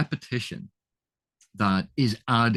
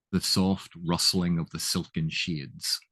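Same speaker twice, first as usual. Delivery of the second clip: "the soft rustling of the silken shades" slow and a little softer, every S drawn out; soft hissed S sounds; 3 semitones lower, slower than usual. Shown as a demonstration. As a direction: let the S sounds hush through "silken shades". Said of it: "Lots of S's in that".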